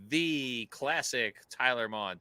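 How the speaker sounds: slow, drawn-out emphasis on each word; admiring drawl. Retold or imitated. Todd praising Tyler's picture, drawn-out admiration. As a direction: stretch it out in retold admiration.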